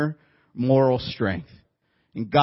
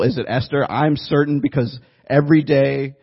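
ordinary talk

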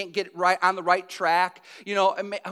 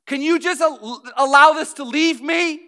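pleading to God at a breaking point, loud and higher; desperate loud plea; 6 semitones higher, 11 dB louder than usual. Retold or imitated. Paul at his breaking point begs God, loud desperate plea. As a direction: cry out to God, voice raised and strained. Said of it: "got to a breaking point and said".

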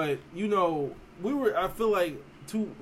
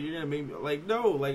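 normal talking voice

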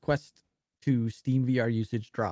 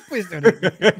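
louder teasing echo through a laugh; mocking echo; 5 semitones higher, 9 dB louder than usual. Cohost teasing him by echoing his apology, mocking. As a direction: throw his plea back at him, giggling.

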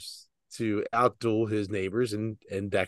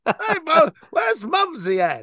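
much higher, slower mock British accent; 4 dB louder than usual. Friends riff on grandma's name, mock British accent. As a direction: call for Mumsy in a silly British accent. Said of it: "like, British".

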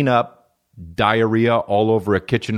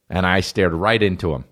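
ordinary delivery

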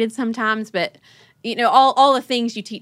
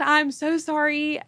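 higher-pitched, mildly pleading mock-tearful apology; retold tearful apology; 7 semitones higher than usual. Crying mom to her daughters after yelling, lightly acted pleading. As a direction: retell a mom apologizing through tears, lightly. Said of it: "I just start crying again".

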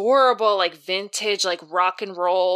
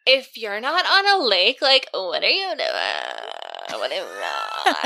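mock vocal fry. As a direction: imitate a lazy TikTok drawl. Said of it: "that TikTok".

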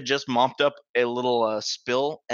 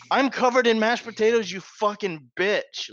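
inner rage; 9 semitones higher than usual. Retold or imitated. Kid silently cursing the janitor, mock outrage. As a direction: vent the insult like a furious kid. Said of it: "that's what I'm thinking".

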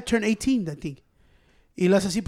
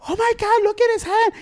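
a much higher, shrieking panicked wife; mock hysterical wife; 13 semitones higher, 4 dB louder than usual. Mocking the bitten man's wife making a fuss, high shriek. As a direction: squeal it like an overdramatic wife. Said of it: "His wife was the one that made the whole fucking big deal".

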